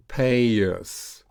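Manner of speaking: "Pay us" joined by a y glide; "Pay us" drawn out with no break; linked practice model. Shown as a demonstration. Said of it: "So let's practice that".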